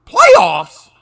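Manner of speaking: loud incredulous Playoffs?; Jim Mora impression. Host answering cohost with loud mock disbelief. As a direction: bark out the disbelief. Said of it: "which one do you find yourself like".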